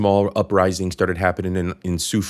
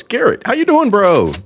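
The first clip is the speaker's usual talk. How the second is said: high-pitched, louder shout-out to a friend; excited greeting; 14 semitones higher, 4 dB louder than usual. Real, not acted. Host welcomes a viewer joining the show, excited greeting. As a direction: greet him with your voice leaping way up.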